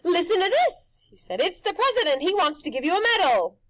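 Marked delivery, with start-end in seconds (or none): 0.0-1.1 loud, high showman pitch; pushy grinning tempter; Rictus's brash voice. A narrator's reading. Rictus on the phone. 1.4-3.6 loud, high showman pitch; pushy grinning tempter; Rictus's brash voice. A narrator's reading. Rictus relaying phone call.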